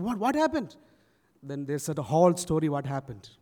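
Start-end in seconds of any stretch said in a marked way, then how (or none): 0.0-0.8 higher, puzzled pastor's question; alarmed question. Retold or imitated. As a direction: ask with voice jumping up. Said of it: "he came out asking".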